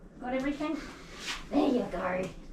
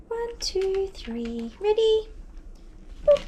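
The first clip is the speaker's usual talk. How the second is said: sing-song count; 9 semitones higher, much slower than usual. Real, not acted. Counting before lifting dog.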